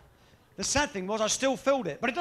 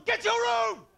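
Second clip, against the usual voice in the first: shouting mum; 13 semitones higher, slower than usual. Retold or imitated. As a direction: yell at the child like an angry mum. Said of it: "Do you remember that one?".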